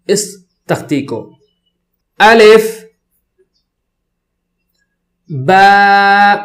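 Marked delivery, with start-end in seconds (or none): 2.2-2.8 الف loud and higher, short second vowel; tajweed letter drill. Shown as a demonstration. Teacher starts the chart with students, الف in loud tajweed style. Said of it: "تجوید کے ساتھ شروع کرتے ہیں". 5.3-6.4 با loud, with a long held level vowel; tajweed letter drill. Shown as a demonstration. Teacher models the letter, با from closed lips, held long. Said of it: "دونوں ہونٹوں کو ملا کر".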